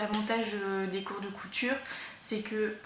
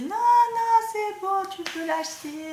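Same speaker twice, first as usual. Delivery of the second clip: self-confessed bad Russian accent, much higher, louder and slow; a hammed-up Russian teacher saying go ahead, pitched way up; 12 semitones higher, 10 dB louder, slower than usual. Sewing teacher telling her student to buy the neoprene, in a bad Russian accent. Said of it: "je fais très mal l'accent russe".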